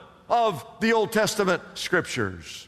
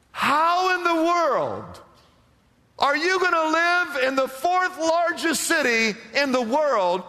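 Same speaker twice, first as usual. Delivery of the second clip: incredulous challenge; 5 semitones higher than usual. Real, not acted. Preacher asks how Christians could live in that culture, incredulous. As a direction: press the congregation in raised-pitch disbelief.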